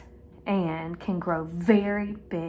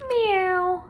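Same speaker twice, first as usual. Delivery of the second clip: higher kitty meow; cartoon cat meow; 9 semitones higher than usual. Shown as a demonstration. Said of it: "the one that says".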